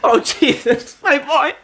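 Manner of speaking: high hammed-up sobbing; overacted grief cry; mock wailing. Mock grief over fallen friend.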